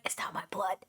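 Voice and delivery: mock whisper; whispered line, half joking. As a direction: breathe it with no voice. Atreus reassuring father.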